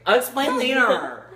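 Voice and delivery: high, loud brush-off. Acted. Steve loudly brushing off the boy. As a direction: shout it over the argument. Heated argument.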